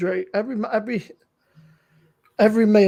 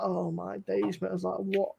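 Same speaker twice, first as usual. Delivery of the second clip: quiet stunned aside; 4 semitones lower, 11 dB quieter than usual. Retold or imitated. Scene: recalling the reviewer's rants, quietly stunned. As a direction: let it fall out in disbelief.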